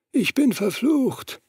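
slow, high whisper of a cursed man. Acted. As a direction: confess it slowly, high and hushed. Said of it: "flüsterte er".

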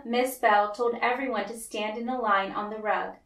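usual speaking voice

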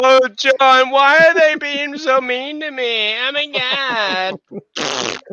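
hammed-up self-pitying complaint; mock whining. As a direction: imitate someone whining to a friend, sinking at the end. Mocks man whining to John.

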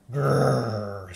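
low rolling playful bear growl. Pretend. Bear in picture book.

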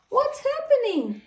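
put-on high, bright little-girl voice; high child voice, puzzled; Peppa's voice. A narrator's reading.

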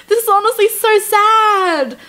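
pitying the penguin husband, slow high heartbroken whine. Real, not acted. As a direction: sound nearly in tears over it. Upset by penguin story.

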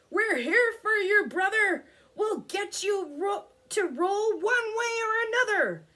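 high, loud hearty character voice; rallying friend. A narrator's reading. Friends promise Ricky they'll help, loud rallying voice. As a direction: cheer a pal on with full conviction.

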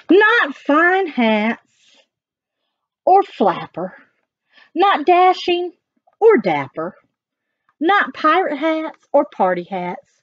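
sing-song it for the little ones; animated read-aloud of the dog's rhymes; reading to children. Acted. Reading rhyming text.

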